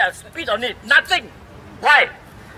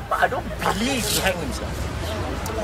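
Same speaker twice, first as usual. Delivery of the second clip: softer, slow, pointed repeat; hushed and slow; 6 semitones lower, 8 dB quieter, slower than usual. Real, not acted. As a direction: lower the voice and drag it out meaningfully. Repeats disbelief, slower and hushed.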